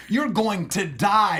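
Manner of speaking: slow, loud taunting shout; smack talk shout. Retold or imitated. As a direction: yell a villain's taunt. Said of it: "smack talking".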